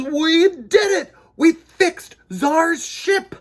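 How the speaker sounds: high, loud, slow cheer; triumphant cheer. Acted. Kids cheering that they fixed the ship, high and loud. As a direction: shout it out in victory. Celebrating the fix.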